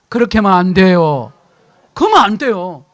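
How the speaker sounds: congregation-style loud repeat of 그렇게 하면 안 돼요; loud firm declaration. Shown as a demonstration. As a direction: proclaim it with force. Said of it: "해봐요. 시작".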